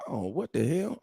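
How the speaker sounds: ordinary talk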